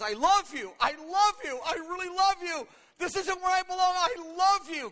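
high, loud, impassioned wail; mock crying. Preacher acting David crying out love to God, loud mock wail. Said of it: "And he's also crying".

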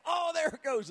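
high, wailing re-enacted pain; high pained exclamation; pained outcry. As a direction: cry out as the pain hits. Acting sudden return of pain.

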